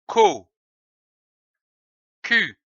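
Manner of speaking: ordinary talk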